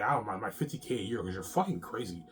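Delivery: ordinary talk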